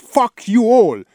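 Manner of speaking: high, loud, slow Fuck you all; furious outburst. Real, not acted. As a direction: spit it out in bitter rage. Bitter outburst at everyone.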